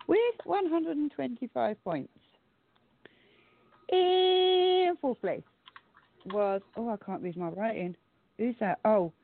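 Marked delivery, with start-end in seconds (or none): 3.9-5.4 high, slow dramatic announcement; slow announcer voice. Acted. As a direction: stretch it out like a game show host. Announcing ranking results.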